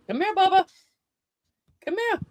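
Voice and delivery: high coaxing call to a dog. Real, not acted. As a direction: sing it up high to coax the pet. Calling the dog sweetly.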